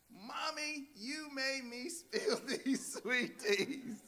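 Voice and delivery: put-on high, slow child's complaint, laughing; whiny little girl. Daughter blaming her mother for the spill, high whiny child voice. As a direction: play the daughter whining at mom.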